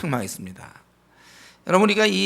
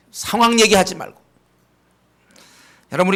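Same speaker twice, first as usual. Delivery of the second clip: ordinary delivery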